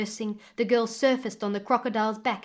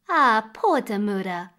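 louder show of false pity; lying; 6 dB louder than usual. A narrator's reading. Stepmother lies to the father about Damura, falsely pitying. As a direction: lay on a little feigned sympathy. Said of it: "lied the stepmother".

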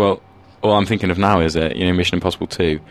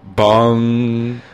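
long sung bong like a news chime; 5 semitones higher, 3 dB louder than usual. Pretend. Bonging the headline chime for the news feature. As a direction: ring out the headline gong.

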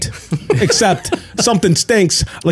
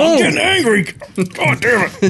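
mock angry; 7 semitones higher than usual. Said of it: "Steve's getting upset".

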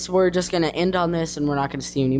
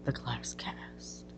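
whisper; 4 semitones lower, 15 dB quieter, much slower than usual. Real, not acted. Unvoiced closing words.

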